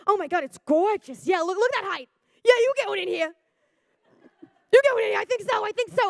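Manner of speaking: high, loud gushing over a van; freaking out. Retold or imitated. Two moms gushing over a stranger's van, excited. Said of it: "they're freaking out about this van".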